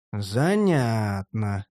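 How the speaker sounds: low, very drawn-out word; drawled musing. A narrator's reading. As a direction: stretch it out slowly with interest. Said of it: "протянул я".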